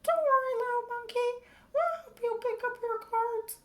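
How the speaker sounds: put-on very high, sweet child voice; a child reassuring the monkey; children's voice. A narrator's reading. Children comforting George, very high and kind. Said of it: "The children gathered up the cards".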